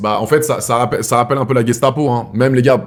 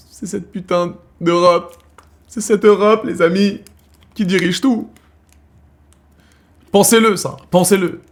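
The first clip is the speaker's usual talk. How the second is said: high, slowed, emphatic outburst against Europe; indignant rant; 9 semitones higher, slower than usual. Real, not acted. Telling viewers Europe runs everything, indignant high rant. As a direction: hammer the point home, pitched way up.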